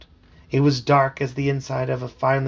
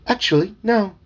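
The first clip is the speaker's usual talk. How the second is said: high, fussy talking frog calling him sir; chirpy, insistent little frog servant; eager frog voice; 9 semitones higher, 4 dB louder than usual. Acted. Dad voicing the talking frog for his kids, high and eager.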